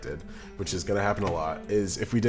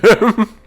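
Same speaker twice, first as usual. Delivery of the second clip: short, loud, high laugh; sudden high cackle; burst of laughter; 13 semitones higher, 13 dB louder than usual. Real, not acted. Amused at being called Jim.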